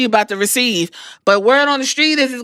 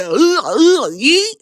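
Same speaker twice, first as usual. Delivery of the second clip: grunted ugh ugh then yeet; mock chant; 2 semitones higher, much slower than usual. Vlogger proposes the crowd chant, mock grunting. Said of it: "So now we gotta say".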